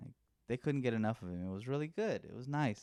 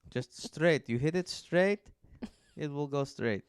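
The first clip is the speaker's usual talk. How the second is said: put-on higher, louder Mexican coach accent; 4 semitones higher, 5 dB louder, slower than usual. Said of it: "Is that supposed to be your Mexican accent?".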